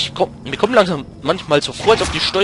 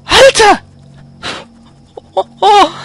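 high, loud startled shout; shocked yell; 8 dB louder than usual. Real, not acted. Gamer startled mid-fight, shocked yell. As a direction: jolt of alarm, cry it out.

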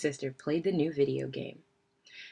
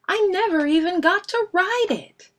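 very high, loud wail; upset tantrum; 13 semitones higher, 7 dB louder than usual. A narrator's reading. Brother stamping about his unridden bike, upset. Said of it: "stamping his feet".

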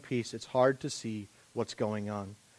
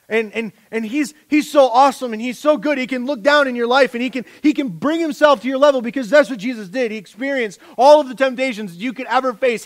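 much higher, much louder, pounding preacher; full-force preaching; 10 semitones higher, 11 dB louder than usual. Acted. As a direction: preach it at the top of your voice.

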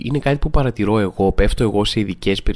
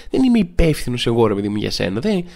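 ordinary talk

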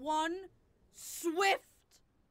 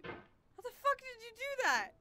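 disbelieving cry; 9 semitones higher than usual. Real, not acted. Stunned at the game.

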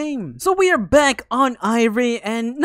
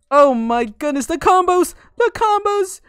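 high, loud, climbing cries; excited exclamation; 7 semitones higher, 6 dB louder than usual. Real, not acted. Gamer reacting to combos mid-fight, excited exclamation. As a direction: exclaim it higher the second time.

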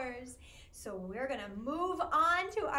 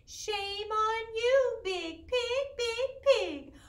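slow, mock scolding, a little higher; tut-tut at the pig like a gentle farmer; 6 semitones higher, 6 dB louder, much slower than usual. Farmer scolding the pig in the rhyme, mock stern. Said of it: "when I'm talking like the little farmer".

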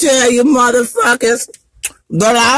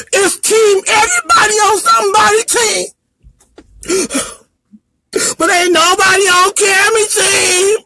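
real sobbing, shouting high through tears; breaking down, voice cracking and much higher, sobbing out each line; crying rant; 6 semitones higher than usual. Real, not acted. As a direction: yell your hurt out through the crying. Breaks down crying while ranting online.

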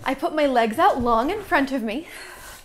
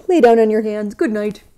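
ordinary delivery

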